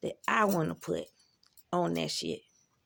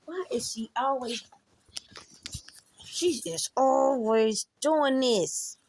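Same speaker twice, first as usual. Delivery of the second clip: louder, slowing indignant complaint; exasperated rant; 4 semitones higher, 5 dB louder, slower than usual. Real, not acted. Complaining about her niece babying her, louder exasperation. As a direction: vent it, getting louder.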